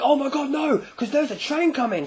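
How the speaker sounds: high mock cry of alarm; panicked onlookers. Crowd seeing a man on train tracks, high mock panic. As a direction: cry out in acted horror.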